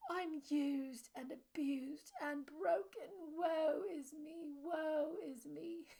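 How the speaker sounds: high, slow, wailing fake British melodrama; extra-dramatic bad accent. Shown as a demonstration. Coach improvising extra drama on anxious thoughts, overacted wailing in a bad British accent. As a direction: ham it up as a woeful bad actor with a poor British accent. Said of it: "you can add on extra drama".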